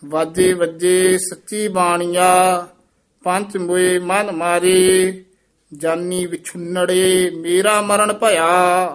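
measured, sustained Gurbani recitation; scripture recitation. Shown as a demonstration. Teacher reciting verse for students, sustained recitation. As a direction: hold the line endings long and even.

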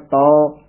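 ਤੌ with open au; wrong pronunciation. Shown as a demonstration. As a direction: say ਤੌ as a long "tau". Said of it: "ਨਾ ਕਹਿਣਾ ਹੈ". (ਤੌ ਕਨੌੜੇ ਨਾਲ)

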